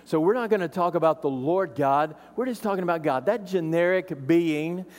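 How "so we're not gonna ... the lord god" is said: ordinary delivery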